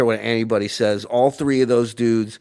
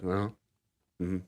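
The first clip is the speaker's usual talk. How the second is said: mild distracted mumble; 5 semitones lower, 13 dB quieter than usual. Imitating distracted boss at keyboard.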